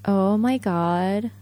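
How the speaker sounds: put-on slow drawn-out valley girl voice. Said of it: "みたいな".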